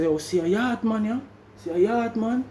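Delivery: friendly Jamaican patois hello. Retold or imitated. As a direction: slip into Jamaican patois to connect.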